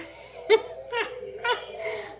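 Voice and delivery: breathless high laughter; real laughter. Real, not acted. Streamer laughing at the echoing live stream, breathless high laugh. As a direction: laughs until it turns to a squeak. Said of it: "This is so funny".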